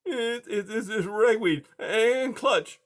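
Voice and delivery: high, hesitant stammer; nervous stop-and-start little voice; halting mouse. A narrator's reading. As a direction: get it out haltingly, like a scared mouse. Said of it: "the mouse said haltingly".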